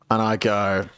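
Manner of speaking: normal talking voice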